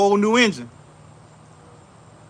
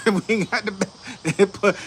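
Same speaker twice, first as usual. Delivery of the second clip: laughing; much slower than usual. Real, not acted. Laughs at his own joke.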